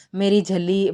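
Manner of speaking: ordinary delivery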